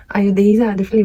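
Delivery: ordinary talk, nothing marked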